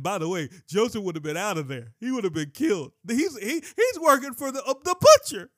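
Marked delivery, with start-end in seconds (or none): 3.1-5.5 rising higher and louder to a near shout; preacher building comic excitement; excited build. Real, not acted. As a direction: ramp up to the punchline.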